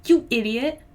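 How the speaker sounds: mild story-reading yell. A narrator's reading. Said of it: "Then I yelled".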